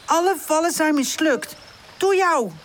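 high-pitched, accusing complaint; understated, high storybook indignation; angry. A narrator's reading. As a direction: blame your friend crossly. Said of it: "zegt Pip boos".

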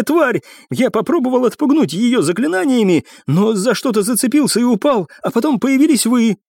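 put-on high-pitched, rattled young cadet; agitated account. A narrator's reading. Cadet explains to rangers how he was left alone, agitated. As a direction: spill the story, still panicked. Shaken cadet reports what happened.

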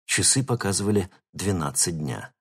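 usual speaking voice

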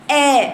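«آ» بفك نازل لأسفل; انطق «آ» والفك السفلي نازل; آ خاطئة. Shown as a demonstration. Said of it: "خطأ". («آ» بنطق خاطئ بإنزال الفك)

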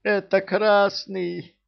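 high, slow squeaky yell; squeaky shout. Acted. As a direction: squeal it out in a thin voice. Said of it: "каким-то писклявым голосом кричит он".

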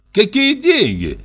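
high, slow, offhand reply; drawn-out, high-pitched feigned indifference; careless, through teeth. A narrator's reading. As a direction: let it out lazily through gritted teeth. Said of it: "Небрежно сквозь зубы процедил Кузьма".

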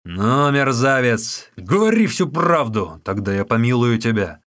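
loud, forceful demand; commanding, heavy-voiced interrogation; shouted threat. A narrator's reading. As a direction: shout it at a captive scoundrel. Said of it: "крикнул".

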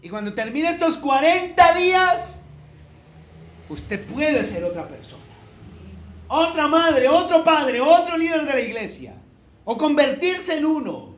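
loud, emphatic preaching, pushed high; fiery preacher call to change; preaching at full force. Acted. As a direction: drive it home to the congregation, full voice. Preaching climax to congregation.

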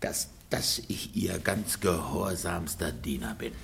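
husband forced to repeat the apology, barely audible; grudging mumble. Acted. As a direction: choke the words out in humiliation.

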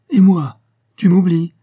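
loud, high-pitched outburst; indignant protest. A narrator's reading. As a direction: protest sharply at being left out. Friend protests being left behind.

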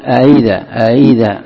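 أإذا with second hamza between hamza and ya; tasheel. Shown as a demonstration. Teacher modelling tasheel, أإذا with an eased second hamza. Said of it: "الهمزة وبين الياء، تقول".

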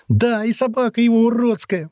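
put-on high, whiny boy's voice; brother chiming in. A narrator's reading. Brother echoing the tattle, whining high. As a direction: pipe up high with the complaint. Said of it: "вторил брат".

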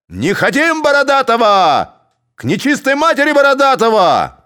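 loud, very high cursing yell; damn him with the whole crowd; angry shout. A narrator's reading. Cossacks cursing Borodaty, angry shouting.

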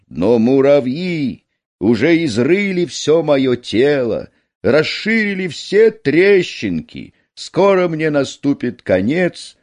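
high, plaintive storybook mountain voice; lamenting mountain. A narrator's reading. Mountain complaining about the ants, plaintive. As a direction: lament helplessly, pitch lifted, slowing at the end.

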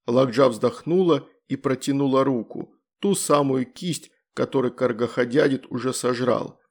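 ordinary delivery, nothing marked

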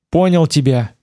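ordinary delivery

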